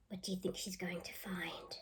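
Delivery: soft breathy question; whisper. Acted. Teacher whispering a question to children. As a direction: whisper it to build suspense.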